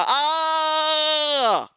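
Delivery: a long, high, ringing आ held out; long aa sound. Shown as a demonstration. Teacher isolates the आ sound for the class. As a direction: sing out the vowel आ on its own. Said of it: "आ का sound निकल रहा है".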